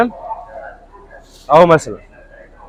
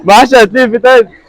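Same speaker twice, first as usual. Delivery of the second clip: raised, higher excited voice; excited insisting; 5 semitones higher than usual. Real, not acted. Friends protest, he insists loudly.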